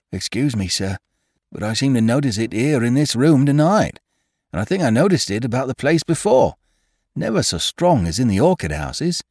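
high, deferential servant voice; a nervous old gardener pleading; humble gardener voice. A narrator's reading.

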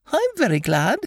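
very high, happy little squeak; happy Piglet. A narrator's reading. Piglet pleased with his gift to Eeyore, happy. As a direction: pipe it up in a tiny pleased voice. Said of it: "said Piglet happily".

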